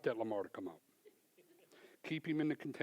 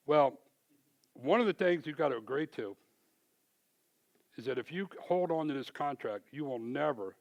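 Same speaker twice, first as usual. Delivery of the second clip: louder, higher laying down terms; retold boss, forceful; stern ultimatum; 3 semitones higher, 6 dB louder than usual. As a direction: dictate conditions firmly.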